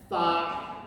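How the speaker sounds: FA as FAT cut before the T; final T unspoken. Shown as a demonstration. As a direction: start to say fat, leave off the T: FA. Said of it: "don't actually say the T".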